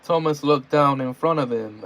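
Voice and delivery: ordinary delivery, nothing marked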